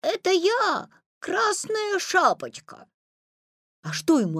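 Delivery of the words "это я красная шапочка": put-on high, drawn-out little-girl voice. A narrator's reading. Petya lying to grandmother at the door, high fake girl voice. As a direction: fake a girlish voice. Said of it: "соврал".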